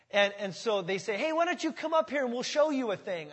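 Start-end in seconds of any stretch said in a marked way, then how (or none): 1.1-3.3 higher taunting challenge; trash talking. Acted. Philistines trash talking Jonathan, higher taunt. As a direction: goad them up the hill. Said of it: "just trash talking".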